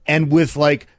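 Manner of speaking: ordinary talk, nothing marked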